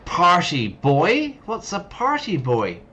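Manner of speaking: high slow disbelief; puzzled high question; surprised. Real, not acted. Incredulous question.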